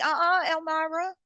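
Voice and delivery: sassy self-scolding. Retold or imitated. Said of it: "I was like".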